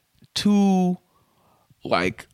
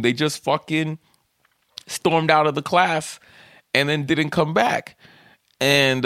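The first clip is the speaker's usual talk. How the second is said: normal talking voice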